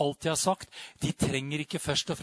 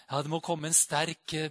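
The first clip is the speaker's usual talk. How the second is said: ordinary talk, nothing marked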